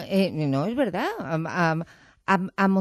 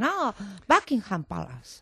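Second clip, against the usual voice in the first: put-on drawn-out English-accented correction; slow mock English accent, sudden realization; imitated English native; 3 dB quieter, slower than usual. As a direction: mimic an English local finally understanding, slowly. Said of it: "Entonces te dicen".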